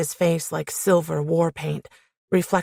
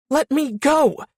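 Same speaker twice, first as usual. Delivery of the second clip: high, frantic yell; desperate demand; 8 semitones higher, 4 dB louder, slower than usual. A narrator's reading. Squirming woman to man holding her, desperate high yell. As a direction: cry out while struggling to get free. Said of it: "The words tumbled out".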